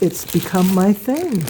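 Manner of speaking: normal talking voice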